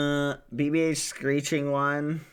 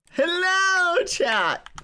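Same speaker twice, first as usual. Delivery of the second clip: very high squeaky cute hello; cute anime streamer; 3 dB louder, slower than usual. Acted.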